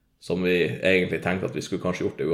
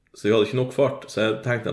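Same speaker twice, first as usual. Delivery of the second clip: ordinary talk, nothing marked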